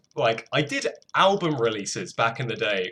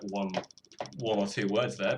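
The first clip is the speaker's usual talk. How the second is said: normal talking voice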